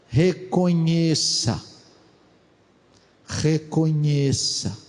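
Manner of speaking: Reconheça said slowly and weightily; slow emphasis. Shown as a demonstration. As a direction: lean on Reconheça. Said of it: "preacher slows on verb".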